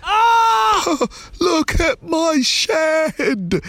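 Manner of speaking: very high, drawn-out wail; shrieking dad, stretched words; dismayed shout. A narrator's reading. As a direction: cry out in horror at the damage. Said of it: "shouted her dad".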